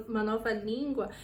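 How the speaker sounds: ordinary talk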